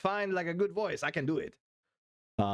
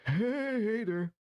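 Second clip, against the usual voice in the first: Biden impression; 6 semitones higher, much slower than usual.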